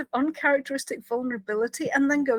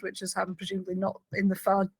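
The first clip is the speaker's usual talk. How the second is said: ordinary delivery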